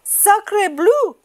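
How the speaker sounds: very high, loud duck voice; high French duck exclamation; French duck crying out. Acted. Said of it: "she cried".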